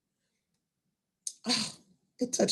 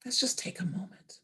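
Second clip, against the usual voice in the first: soft whispered pause for the audience; 9 dB quieter than usual. Shown as a demonstration. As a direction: breathe it out as a whisper. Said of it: "you just need to say".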